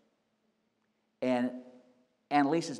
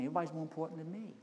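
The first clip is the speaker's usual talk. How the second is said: softer and fast, a murmured repeat; congregation repeat, quick; 3 semitones higher, 9 dB quieter, faster than usual. Shown as a demonstration. Congregation repeating the pastor's words softly. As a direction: echo it back quickly. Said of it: "You say the same thing".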